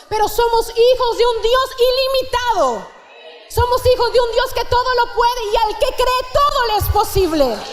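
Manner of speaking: rising pulpit exhortation, hammering the promises; fervent preaching. Acted. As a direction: preach it out to the congregation with conviction. Preaching at full force.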